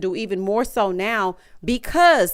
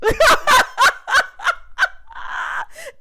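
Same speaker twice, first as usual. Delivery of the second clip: high wailing laugh; shrieking laugh; 4 dB louder than usual. Real, not acted. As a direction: scream with laughter. Reacting to Karen's court date.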